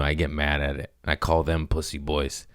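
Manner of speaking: usual speaking voice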